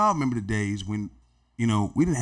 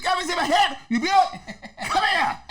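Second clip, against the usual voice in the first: much higher, laughing call. Retold or imitated. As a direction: beckon the kid over. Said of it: "He was saying".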